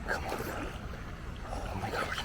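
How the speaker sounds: tense whispered plea; whispering. Real, not acted. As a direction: breathe the words, barely voiced. Tense landing moment.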